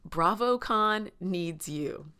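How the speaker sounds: slow, weighty BravoCon needs you; solemn quote. Retold or imitated. Quoting her sister's grave encouragement: slow solemn. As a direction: deliver it like a rallying call. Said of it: "and I quote, my sister said".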